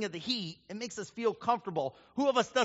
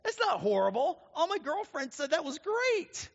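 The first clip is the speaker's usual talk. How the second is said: indignant disbelief; 6 semitones higher, 3 dB louder than usual. Retold or imitated. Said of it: "She's like".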